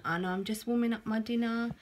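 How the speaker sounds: ordinary talk, nothing marked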